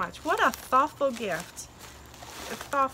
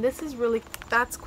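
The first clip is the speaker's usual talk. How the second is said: ordinary delivery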